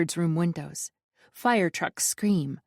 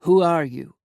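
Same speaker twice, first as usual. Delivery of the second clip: growled demand; 7 dB louder than usual. A narrator's reading. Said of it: "Gavriel growls".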